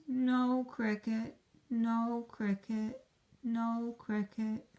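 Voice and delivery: soft, drooping little cricket voice; sad cricket. Acted. Rejected cricket answering the princess, softly sad. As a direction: let it sag, the cricket wasn't picked. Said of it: "He is sad".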